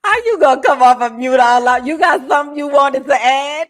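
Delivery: loud, high, fast teasing jab; loud mock accusation at the friend who unmuted; teasing call-out. Real, not acted. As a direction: call her out for unmuting, laughing.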